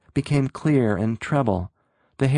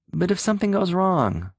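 high, wavering and unsure; uncertain; 8 semitones higher than usual. A narrator's reading. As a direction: let the voice trail off unsure. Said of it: "The voice broke off uncertainly".

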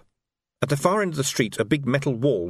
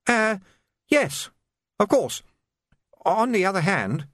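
higher, hesitant junior officer; nervous captain; 9 semitones higher than usual. A narrator's reading. Captain hesitantly agreeing with the Major, higher. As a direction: stammer out agreement.

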